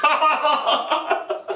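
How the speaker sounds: high, loud burst of laughter; long, high-pitched cackling laugh; big laugh. Real, not acted. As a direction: crack up at your own punchline. Laughs at his own punchline.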